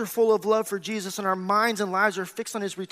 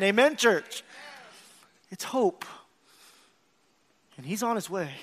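usual speaking voice

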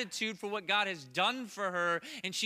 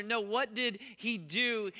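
ordinary delivery

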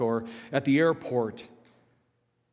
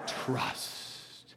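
whispered trust; 10 dB quieter than usual. Shown as a demonstration. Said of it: "Say it like you mean it".

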